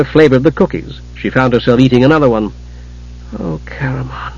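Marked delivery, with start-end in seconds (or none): 3.4-4.3 soft, sighed, much quieter; sighing. Acted. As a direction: breathe his name out wearily. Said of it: "Tika sighed".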